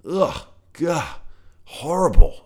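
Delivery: high, loud disgusted retch in a toad voice; an old toad spitting out a bug, disgusted. A narrator's reading. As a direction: gag on the foul taste. Toad spits out bad taste.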